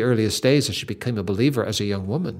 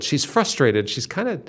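ordinary delivery

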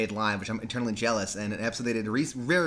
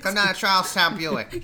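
slow, raised Boston ad pitch; mock Boston car-dealer sign-off; hammed-up Boston accent; hard Boston accent; 5 semitones higher, 3 dB louder than usual. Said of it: "it's a hard Boston".

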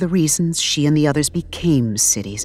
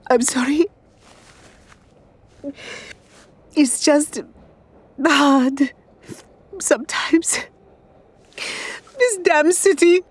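high, tearful and strained; 11 semitones higher than usual. Acted. Woman crying to strangers on the train, high tearful apology. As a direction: let the tears push the voice up. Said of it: "Padmini cries harder".

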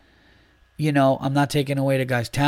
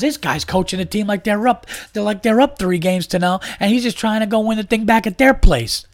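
much higher, building louder rant; imitating a ranting friend; 9 semitones higher, 4 dB louder than usual. Friend mocks the coach's game plan, high escalating rant. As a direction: climb in pitch and volume like your buddy losing it. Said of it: "And I'm just crying".

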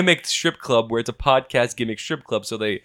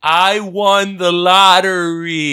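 drawn-out mock boast; 5 semitones higher, 4 dB louder, much slower than usual. Mock announcement of winning.

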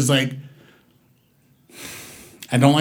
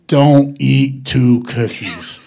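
stoned drawl; 3 semitones lower, much slower than usual. Retold or imitated. Stoned slow advice.

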